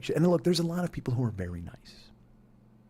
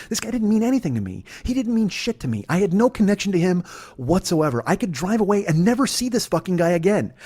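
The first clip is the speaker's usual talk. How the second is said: much higher and louder, urgent; impassioned; 8 semitones higher, 7 dB louder than usual. Real, not acted. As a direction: preach the realization with rising force. Revelation delivered in fervent rush.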